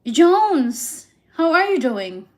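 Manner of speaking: normal talking voice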